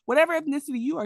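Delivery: high, loud, fast indignant comeback; mock outraged anchor; heated retort. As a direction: fire it back, offended and quick. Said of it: "And he's like".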